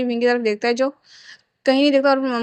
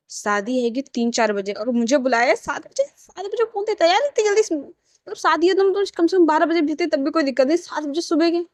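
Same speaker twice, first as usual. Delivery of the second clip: higher, mock-grumbled complaint about the early hour; a young man grumbling to himself about being called at seven, in a higher mock-grumble; 5 semitones higher than usual. A narrator's reading. Wing complains under his breath about the seven o'clock call, grumbling in a higher voice. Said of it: "मन में बड़बड़ा रहा था".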